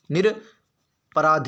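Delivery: निरपराध split as "nir... paraadh"; very slow dictation of निरपराध with a gap; slow syllable-by-syllable. Shown as a demonstration. Teacher repeating the answer, निरपराध slowly broken in two. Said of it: "शब्द क्या होता है?".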